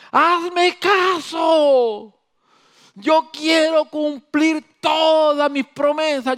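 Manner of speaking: grito alto y lento, suplicante desde el cielo; Dios gritando. Acted. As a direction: clama obedéceme, muy alto y alargado. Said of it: "Dios está gritando desde el cielo". (Dios grita desde el cielo a su pueblo, más alto y lento)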